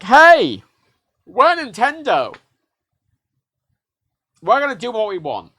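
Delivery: loud, high, slow cocky brand voice; brash Nintendo voice. Pretend. Mocking Nintendo to the viewers, a loud high swaggering brand voice. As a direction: boom it like a swaggering mascot.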